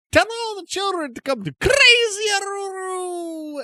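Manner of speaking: high, loud crazed cry; shouting merchant. Acted. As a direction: yell it like a showman. Merchant calls out, spinning.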